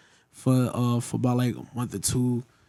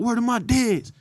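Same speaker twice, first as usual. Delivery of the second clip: pitched-up questioning take on New York slang; mocking New York accent; 8 semitones higher, slower than usual. Southerner querying New Yorkers' slang, mock New York accent. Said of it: "I used to clown them".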